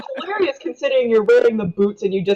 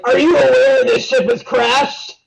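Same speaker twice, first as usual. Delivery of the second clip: raised, higher voice confronting the captain; loud challenge; 4 semitones higher, 4 dB louder than usual. Acted. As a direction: call him out loudly, mock-officious. Player confronts the captain in character.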